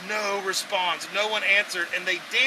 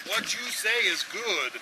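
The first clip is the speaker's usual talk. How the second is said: stiff formal translation; 4 dB quieter, slower than usual. Retold or imitated. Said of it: "That's just how they translate it".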